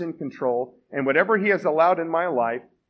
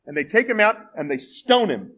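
normal talking voice

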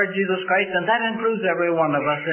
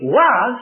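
"was" punched higher and much louder; stress on was; 6 semitones higher, 8 dB louder than usual. Shown as a demonstration. Preacher contrasting "was" with "came to be". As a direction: hit "was" hard against "came to be". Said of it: "notice the contrast".

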